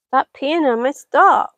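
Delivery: loud, sharp scold at the cat. Real, not acted. Gardener scolds the cat peeing on her plants, loud and sharp. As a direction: tell the cat off firmly.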